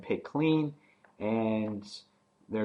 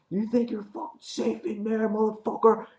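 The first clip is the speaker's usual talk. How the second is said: much higher, sneering taunt; taunting Nicholas; 11 semitones higher than usual. Retold or imitated. Nicholas taunting Glenn inside, much higher sneer. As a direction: jeer at them through the door. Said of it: "Then Nicholas goes".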